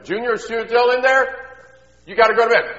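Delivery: high, loud call into another room; mom calling out. Acted. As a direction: holler for your son like a nagging mom. Mom calls from other room.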